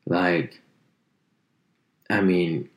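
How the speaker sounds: ordinary talk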